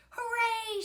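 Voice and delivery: high, loud hooray; cheering shout. A narrator's reading. Mom celebrates her son's landing, cheering shout. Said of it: "shouted Mom".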